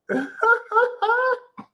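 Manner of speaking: a high-pitched squeal of laughter. Real, not acted. Laughing at own rant.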